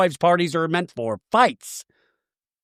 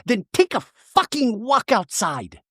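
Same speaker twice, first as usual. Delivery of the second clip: higher, slow, exasperated jab; mock exasperated Shannon; 6 semitones higher, slower than usual. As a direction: spit out the curse, exasperated. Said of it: "And Shannon goes".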